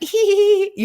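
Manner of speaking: high-pitched fake tee-hee; mock giggle. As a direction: put on a squeaky, sheepish giggle. Imitates own nervous giggle to guards.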